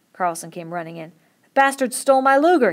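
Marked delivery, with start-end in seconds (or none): 1.4-2.7 much higher and louder outcry; shout. A narrator's reading. Carlson telling the men his gun is gone, shouting. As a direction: bellow the news. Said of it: "he shouted".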